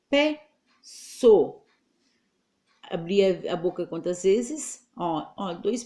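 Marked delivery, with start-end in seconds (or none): 0.1-1.6 slow demo word. Shown as a demonstration. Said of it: "Se eu boto o C cedilha".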